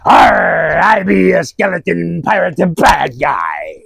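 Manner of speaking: loud, growling pirate shout. Acted. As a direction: snarl like a skeleton pirate. Pirate villain in fake ad.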